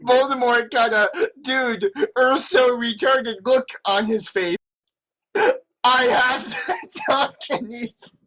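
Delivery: high, laugh-choked reading; words squeezed out through giggles; reading through laughter. Real, not acted. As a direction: try to read while cracking up.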